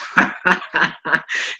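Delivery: breathy run of chuckles; laugh. Real, not acted. Teacher amused by talk of rolling R's, laughing. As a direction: chuckle freely at the comment.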